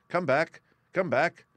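calling out. A narrator's reading. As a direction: call the frightened mice back. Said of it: "while he called to the mice".

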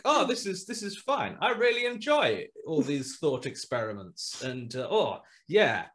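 high, loud mock cheer; hammed-up breezy voice of a smug bloke laughing it off; mocking complacent guy. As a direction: lampoon a smug guy shrugging off horror. Mocking a complacent horror fan.

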